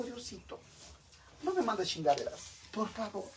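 high, small, soft voice; imitated pleading prayer. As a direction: beg God meekly, hushed. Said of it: "Y le digo". (súplica aguda y bajita a Dios)

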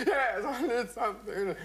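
high, slow put-on lady voice; hammed-up tearful widow; mock widow. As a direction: play the fragile widow. Widow accepts a brandy.

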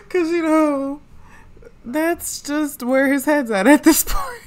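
high laughing words. Real, not acted. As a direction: laugh through the line. Laughing at game.

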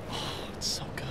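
breathy, hushed swoon; awed whisper. Real, not acted. Player admiring a rainbow sheen, awed whisper.

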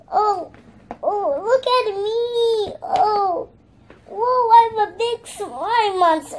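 high, loud, excited play voice; playful showing off. Pretend. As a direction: call out to show off the slime costume. Showing off as slime monster.

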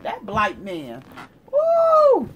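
ordinary delivery

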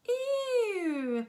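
high disgusted screech; squealing ew at a horrible smell. A narrator's reading. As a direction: shriek out a girl's disgusted ew. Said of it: "screeched a girl".

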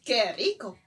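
normal talking voice

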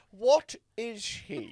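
baffled Dutch skipper, each word stretched out; mock Dutch captain. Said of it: "the Dutch captain goes".

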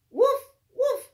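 loud, high playful woofs; dog barks. Acted. Teacher barking for Biscuit the puppy, loud and playful. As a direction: bark like a happy puppy.

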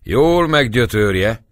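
shouting back. A narrator's reading. Gáspár shouting back to the priest, loud. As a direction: call back over the shoulder. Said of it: "kiáltott vissza a papnak".

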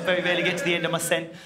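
fast, swallowed words; rushed London run-on. Shown as a demonstration. Comic showing London speech runs words together. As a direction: rattle it off without finishing words. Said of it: "not finishing words".

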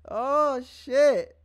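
surprised outburst. Real, not acted. Sudden reaction mid-stream.

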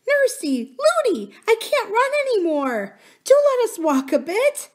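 put-on very high, whining little girl; pleading child princess. A narrator's reading. Princess begging nurse to walk a bit, high plea.

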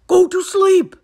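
high, very loud exclamation; shout. A narrator's reading. Toad remembering his list, shouting. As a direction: shout it in sudden realisation. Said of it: "shouted Toad".

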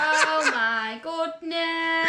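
slow, laughing through it. Real, not acted. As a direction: laugh out the words. Hears the ice bucket rules.

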